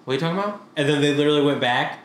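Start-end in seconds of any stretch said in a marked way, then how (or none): 0.0-0.6 quick, slurred-together question; said too fast. Retold or imitated. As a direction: repeat "What are you talking about?" as one rushed blur. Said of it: "Exactly how you said it before".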